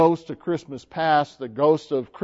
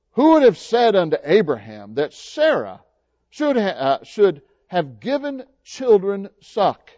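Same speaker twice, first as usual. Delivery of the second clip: joyful Sarah; 5 semitones higher, 5 dB louder than usual. Acted. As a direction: stretch the words out in joyful wonder. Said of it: "joyful as anybody could be".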